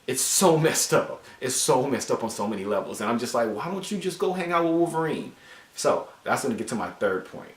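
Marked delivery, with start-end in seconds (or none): 3.5-5.3 high-pitched exasperated question; exasperated plea. Acted. Reviewer telling Jean to go to Wolverine, exasperated. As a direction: plead in disbelief, voice pitched way up. Pleading with a comic character.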